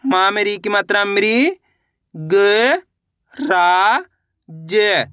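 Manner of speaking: very slow, each syllable drawn apart. Shown as a demonstration. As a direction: spell it out slowly, piece by piece. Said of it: "तो मिलके बन जाएगा मृगराज". (अक्षर-अक्षर धीरे-धीरे)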